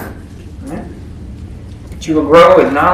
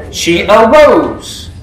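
slow dramatic reveal; 3 semitones higher, much slower than usual. Acted. Dramatic climax of miracle.

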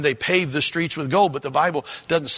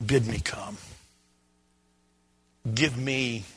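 earnest prayer; 4 semitones lower, 5 dB quieter than usual. Pretend.

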